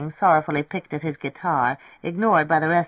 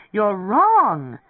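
high, drawn-out shriek; 5 semitones higher, 4 dB louder than usual. A narrator's reading. Lemming objects to Poor Butterfly, shrieking. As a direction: shriek with a soaring last word. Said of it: "Lemming shrieked".

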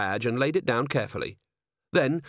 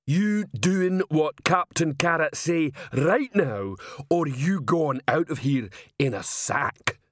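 slow, raised-pitch troll ultimatum; threatening troll; 5 semitones higher, slower than usual. Acted. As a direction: menace him as a troll enforcer.

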